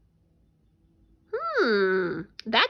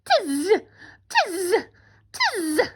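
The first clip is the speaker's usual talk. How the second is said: higher buzzy monkey chatter; teasing monkeys; 6 semitones higher, much slower than usual. Acted. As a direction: mimic the monkeys in short high buzzes. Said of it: "monkeys only shook both their hands".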